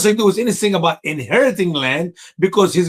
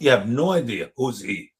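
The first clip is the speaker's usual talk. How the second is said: ordinary delivery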